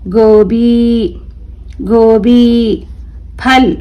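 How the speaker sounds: each word drawn out slowly, clearly; careful drawn-out classroom reading; slow word-list reading. Shown as a demonstration. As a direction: read it slowly for children to repeat. Teacher reads letters and words again.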